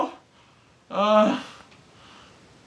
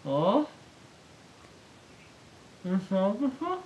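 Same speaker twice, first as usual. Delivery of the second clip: ordinary talk, nothing marked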